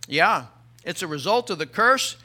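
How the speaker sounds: normal talking voice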